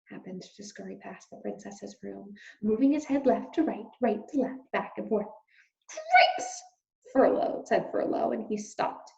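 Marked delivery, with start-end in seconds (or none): 5.9-6.6 high squeaky mouse shout; a startled squeak of shock; high squeaky mouse exclamation; alarmed shout. Acted. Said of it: "shouted Furlough again".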